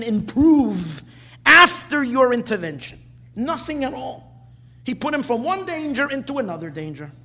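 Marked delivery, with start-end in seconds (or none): none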